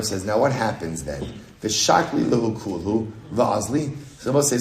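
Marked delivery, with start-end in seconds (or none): none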